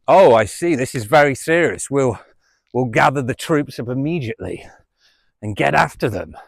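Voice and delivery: officer solenne e finto serio, un po' più forte; mock police officer. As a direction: fai il poliziotto che prende sul serio una sciocchezza.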